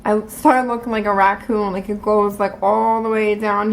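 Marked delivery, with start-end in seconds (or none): none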